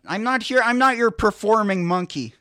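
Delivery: high, loud indignant outburst; exasperated refusal to perform; indignant protest. Real, not acted. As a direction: refuse loudly, pitch jumping up. Refuses demand for impressions.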